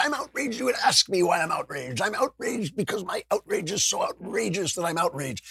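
high-pitched, hammed-up indignation; strained high voice sputtering in mock fury; mock outrage. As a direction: imitate someone endlessly outraged. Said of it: "like, angry people".

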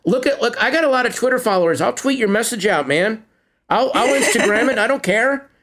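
high, eager pitch to the aliens; eager mock plea. Talking to aliens, promising to tweet their message, eager and high. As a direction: sell yourself to the aliens.

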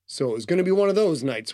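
ordinary delivery

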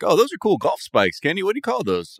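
high, chummy golf buddy voice; lightly voiced preppy golfer; mock golf buddy. Golf buddies voiced mockingly.

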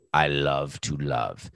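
slow, drawn-out theatrical line; hammy stage actor. Pretend. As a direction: deliver it like a ham actor. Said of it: "I say it like an actor now".

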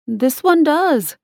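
put-on high, slow boy voice; a small boy insisting, slowly; young boy voice. A narrator's reading. Child voiced in story.

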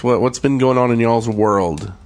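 usual speaking voice

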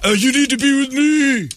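high-pitched, whiny put-on pleading; mock romantic hero plea. Movie dude begs someone's wife, hammed-up pleading.

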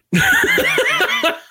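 squealing high laugh; real laugh, far up in the voice; high burst of laughter. Real, not acted. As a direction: break into a high-pitched laugh. Bursts out laughing at own joke.

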